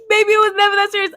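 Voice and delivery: quick, high teasing reply. Retold or imitated. Student teasing the teacher, quick and high. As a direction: brush it off playfully. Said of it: "I was like".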